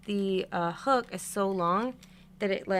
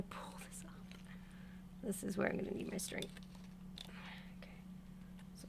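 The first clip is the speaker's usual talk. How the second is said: whisper; 16 dB quieter than usual. Real, not acted. Straining to pull doll part.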